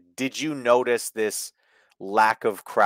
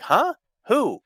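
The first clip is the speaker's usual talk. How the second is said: much higher squeaky puzzled "huh, who"; mock confusion; 12 semitones higher, 3 dB louder than usual. Stablemates baffled by the question, mock confusion. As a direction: play them baffled, voice jumping up. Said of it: "they were like".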